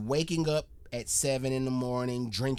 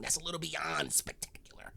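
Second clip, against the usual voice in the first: whispered, much softer aside; 10 dB quieter than usual. Real, not acted. Host to co-host, whispering in awe. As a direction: drop to a whisper for the punchline. Hushed aside about amazing stories.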